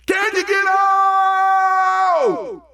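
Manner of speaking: high, loud, very long-held shout; huge high-pitched rabbit yell; hollering rabbit. Acted. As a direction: holler it, stretching the last word out. Rabbit hollers back to the frog.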